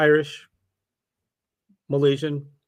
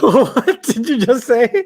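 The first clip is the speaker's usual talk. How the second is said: high, drawn-out incredulous question through laughter; amused, squealed astonishment; laughing disbelief; 9 semitones higher, 4 dB louder, much slower than usual. Real, not acted. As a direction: crack up while asking. Teacher laughs at cheating accusation.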